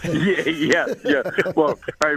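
laughing loud yeah yeah. Real, not acted. Laughing at the jab about Ridgie. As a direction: burst out laughing, loud and high.

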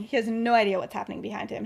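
ordinary delivery, nothing marked

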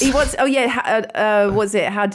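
ordinary talk

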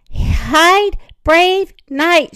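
high, loud urging; urgent warning. A narrator's reading. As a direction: call the knight to cover. Mom warns Victor of danger.

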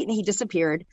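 ordinary talk

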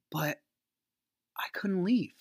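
"I couldn't leave" in a hushed whisper; husband admitting he could not leave, whispered; whispered confession. Real, not acted. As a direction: let it drop to a whisper. Confessing he was trapped.

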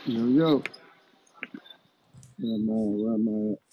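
thin, tinny Bluetooth phone sound; bluetooth headset mic. Real, not acted. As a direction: talk through a Bluetooth headset. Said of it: "you sound like you're on Bluetooth".

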